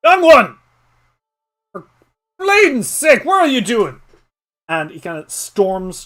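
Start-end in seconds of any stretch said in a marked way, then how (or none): 0.0-4.0 high, loud alarmed shout; old man shouting in alarm. Acted. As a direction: yell at the wandering child. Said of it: "as he says".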